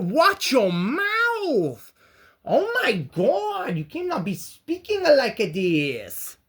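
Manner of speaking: mock scandalized scold. Mock scolding of own swearing.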